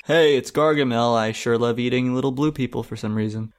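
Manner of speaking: usual speaking voice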